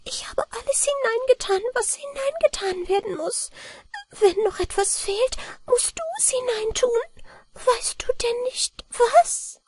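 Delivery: very high, soft little-man voice, downcast; a tiny helper admitting he is out of ideas; sad mannikin. A narrator's reading. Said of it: "sagte das Männlein traurig".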